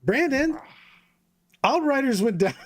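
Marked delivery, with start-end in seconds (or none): 1.6-2.7 breaking into high laughter mid-line; can't hold it together, laughing. Real, not acted. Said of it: "I can't even say that without laughing".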